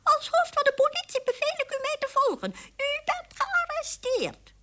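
high, tiny-voiced police order; a mouse trying to sound official; squeaking authority, slowing on the arrest; squeaky official command. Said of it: "ging op zijn achterpootjes staan en riep".

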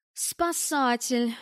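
slow, drawn-out venomous sneer; venomous hiss. A narrator's reading. The lady sneering at the rescuer, venomous hiss. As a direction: spit the word out with venom. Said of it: "ядовито прошипела дамочка".

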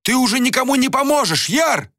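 fast, very high desperate appeal; urgent high-pitched cry of protest; agitated insistence. A narrator's reading. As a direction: press the point rapidly and frantically. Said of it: "не успокаивался Анатан".

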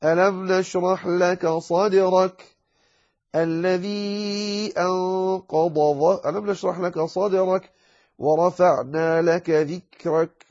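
slow, drawn-out tajweed recitation; Quran recitation. Shown as a demonstration. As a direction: recite the verses slowly and melodically. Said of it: "في قول عز وجل في سورة ألم نشرح".

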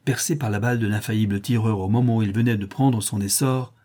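ordinary delivery

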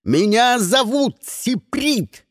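slow, high-pitched furious insistence; drawn-out angry cry; growled roar. A narrator's reading. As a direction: hammer out the name in a rage. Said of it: "прорычал Сиприт".

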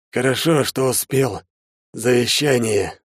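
ailing but relieved; smiling through pain. A narrator's reading. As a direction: force the words through pain with a smile. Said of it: "улыбнулся сквозь боль Вяземский".

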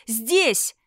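loud repeated call; shouting for help. A narrator's reading. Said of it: "закричала во всю силу лёгких". (громкий крик)

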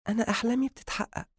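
confident high-pitched female character; self-assured woman. Acted. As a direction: retort coolly in a high woman's voice. Narrator voices woman.

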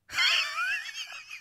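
shrieking high evil cackle; a piercing witch-like villain laugh. Shown as a demonstration. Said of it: "điệu cười phản diện".

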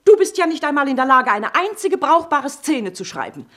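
high and loud, fast and angry; furious shouting. Acted. Mother shouting insults back at her son. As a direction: lash back at him with insults.

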